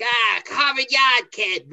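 slow, clumsy attempt at a Boston accent; a failed Boston accent, drawn out; bad Boston accent. Shown as a demonstration. Speaker showing he can't do a Boston accent. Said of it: "I can't even do a Boston accent".

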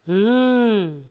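low, drawn-out villain hum; a deep "hmm" from a demon king; low, brooding stage-villain hum; Ravan. Acted. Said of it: "मैं रावण हूँ".

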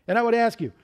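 ordinary delivery

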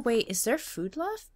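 usual speaking voice

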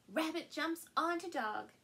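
usual speaking voice

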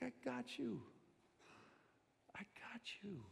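soft, fading to a whisper; gentle reassurance. Pretend. Jesus reassuring a broken person, soft to whisper. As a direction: hush them with comfort.

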